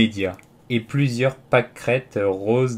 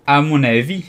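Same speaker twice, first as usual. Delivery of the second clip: stern, high and loud opening; firm, raised-voice reproof; severe observation; 4 semitones higher, 6 dB louder than usual. A narrator's reading. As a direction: pronounce it severely. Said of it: "fit Observation sévère".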